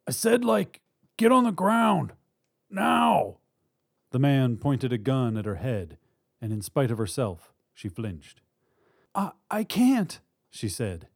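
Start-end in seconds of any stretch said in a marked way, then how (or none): 0.1-3.4 loud, high-pitched command; barked order. Acted. Gunman to hostage in bank, loud urgent order. 9.1-10.2 high, halting and slow; frightened plea. Acted. Woman at gunpoint to robber, frightened plea.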